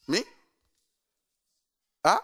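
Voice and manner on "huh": normal talking voice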